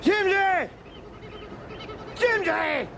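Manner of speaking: high calling shout across the hills. Acted. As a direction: call his name up to the mountains.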